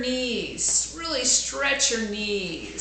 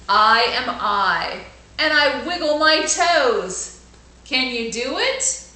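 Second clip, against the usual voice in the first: put-on bright, louder storybook reading for kids; lively read-aloud; 3 semitones higher, 8 dB louder than usual. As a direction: read it out big for the class.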